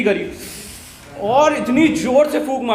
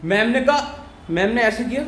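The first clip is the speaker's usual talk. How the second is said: ordinary talk, nothing marked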